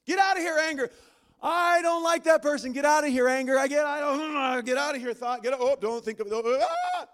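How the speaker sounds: high, loud, rising fluster into a laugh; frantic mock commands. Preacher acting out fighting his own thoughts, loud and flustered. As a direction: shout thoughts away until it falls apart. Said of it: "how often does that work".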